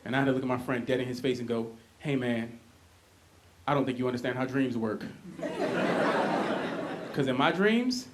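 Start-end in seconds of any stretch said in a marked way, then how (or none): 2.0-5.0 low, soft deadpan; deadpan retelling. Retold or imitated. Telling friend dreams don't work. 5.4-7.1 breathy high laugh; airy laugh. Real, not acted. Laughing at own joke.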